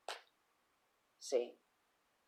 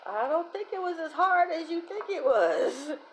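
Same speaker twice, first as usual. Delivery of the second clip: high-pitched mock-innocent jab; high teasing; 9 semitones higher, 4 dB louder than usual. Reader ribbing aunt's ex-husband, high-pitched tease. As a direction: lift the whole line up teasingly.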